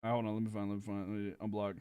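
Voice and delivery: very quiet, low talk to oneself; absorbed muttering. Real, not acted. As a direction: mumble under your breath while searching. Muttering to self while searching.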